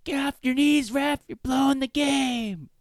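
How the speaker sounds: high, loud mock auntie holler; yelling at refs. Auntie berating the ref, imitated loud high yelling. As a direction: heckle in a stretched, high-pitched yell. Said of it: "just yelling at the refs".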